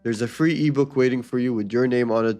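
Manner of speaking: ordinary delivery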